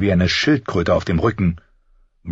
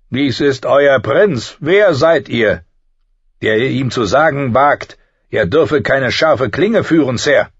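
louder, raised, imperious challenge; menacing burned knight; 7 semitones higher, 5 dB louder than usual. A narrator's reading. Scarred knight challenging the master-at-arms, imperious. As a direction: challenge him loudly as an intimidating knight.

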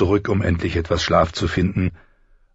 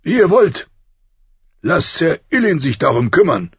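loud, high, testy dismissal; irritated king; 12 semitones higher, 6 dB louder than usual. A narrator's reading. King dismissing Ned's protest with a shrug, irritated. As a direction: wave it off loudly, annoyed. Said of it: "zuckte der König gereizt mit den Schultern".

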